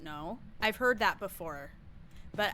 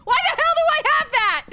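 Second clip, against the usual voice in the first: high, fast shriek of disbelief; startled screech at top volume; real shriek; 13 dB louder, faster than usual. Real, not acted. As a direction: shriek it out, baffled and loud. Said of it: "Sorry for shrieking".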